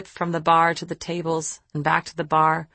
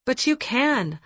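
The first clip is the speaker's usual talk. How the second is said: higher-pitched, earnest protest; insistent; 7 semitones higher, slower than usual. A narrator's reading. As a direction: push back, insisting. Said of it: "I insisted".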